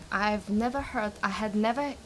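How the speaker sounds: normal talking voice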